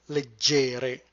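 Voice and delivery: leg-GE-re, stressed, lengthened middle syllable; stress on second syllable. Shown as a demonstration. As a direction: put the weight on GE in "leggere". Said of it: "put the stress on the second syllable".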